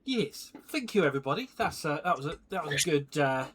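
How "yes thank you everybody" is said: ordinary talk, nothing marked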